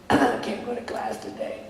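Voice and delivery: soft, slow pretend-sick phone voice; feigned sickness. Student calling in to skip class, feigned sickness. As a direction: put on a weak, poorly voice to skip class. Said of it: "You're not sick".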